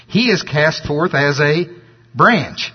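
slow build to a pause, then punch "branch"; "branch" singled out after a beat; stress on branch. Shown as a demonstration. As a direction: leave a gap and drop "branch" in with weight. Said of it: "gonna emphasize the word branch".

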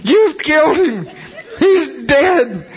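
high, drawn-out mock scream; screaming in alarm. Doctor with stethoscope, no heartbeat, screaming at the preacher. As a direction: wail it way up high, as the aghast doctor. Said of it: "starts screaming out".

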